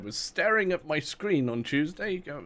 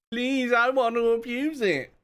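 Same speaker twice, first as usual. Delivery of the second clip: pleading whine; 10 semitones higher, 4 dB louder, slower than usual. Real, not acted. Begging to use the system.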